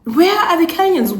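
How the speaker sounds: calling out. Real, not acted. Calling out for Kenyans.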